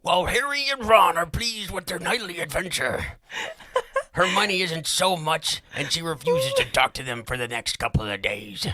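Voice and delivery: high, loud hammed-up Gollum. Shown as a demonstration. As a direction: do a laughing Gollum read. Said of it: "who I was trying to channel".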